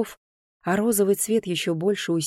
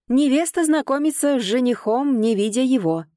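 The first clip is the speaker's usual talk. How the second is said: high, carrying steward's cry; shouted announcement; 7 semitones higher, 4 dB louder than usual. A narrator's reading. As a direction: call it out over the crowd. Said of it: "выкрикнул распорядитель". (выкрикивая, как распорядитель)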